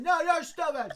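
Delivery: laughing retort. Retold or imitated. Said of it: "I said".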